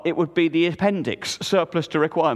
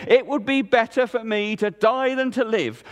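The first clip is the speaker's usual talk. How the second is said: higher, pompous proclamation; grand declaration; 7 semitones higher than usual. A narrator's reading. Jonah quoting Elijah, hammed-up grandeur. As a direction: puff up and declare like a prophet. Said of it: "puffed out his ragged chest and declared".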